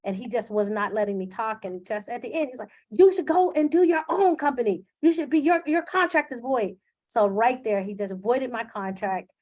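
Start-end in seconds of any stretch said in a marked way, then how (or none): 2.9-6.8 high, loud furious rant; imitating the furious owner's tirade; angry yelling owner. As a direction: yell it like the irate owner firing her. Owner yelling, voiding her contract.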